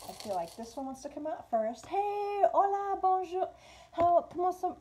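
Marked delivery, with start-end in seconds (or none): 1.9-4.8 high, louder, sing-song hello in Spanish and French; playful foreign greeting. Real, not acted. Greeting viewers playfully in French, high and louder. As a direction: greet them brightly in borrowed languages. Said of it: "I don't know why I'm trying to speak French today".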